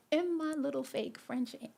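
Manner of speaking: high, giggly self-mockery; amused, self-mocking. Real, not acted. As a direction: say it high through a rising laugh. Laughing at own fake French accent.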